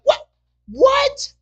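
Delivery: very high, loud mock-shocked squeal; shocked disbelief. Man reacting to the woman's sudden accent, exaggerated shock.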